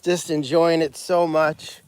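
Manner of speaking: ordinary talk, nothing marked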